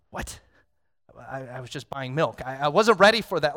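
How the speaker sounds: startled, rising to a loud I wasn't ready; taken aback while buying milk; mock surprise. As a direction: play it caught off guard. Imagined shock at the sudden request.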